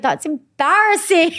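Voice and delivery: high, drawn-out cringe; mock mortified. Objecting to producers' cheesy catchphrase, mock mortified. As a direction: stretch it out in embarrassment.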